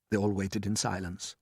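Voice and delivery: ordinary delivery, nothing marked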